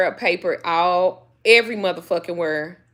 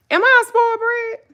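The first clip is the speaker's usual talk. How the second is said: mock baby question; 5 dB louder than usual. Asks dog in high mock voice.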